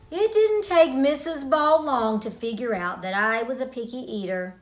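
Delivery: ordinary delivery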